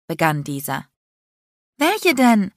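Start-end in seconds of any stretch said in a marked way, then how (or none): 1.8-2.5 high, eager interruption; hopeful. A narrator's reading. Bree interrupting Landon, hopeful. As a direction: jump in, full of hope. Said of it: "unterbrach ihn Bree hoffnungsvoll".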